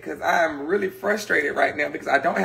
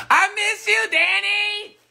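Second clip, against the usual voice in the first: high sing-song call; slower than usual. Real, not acted.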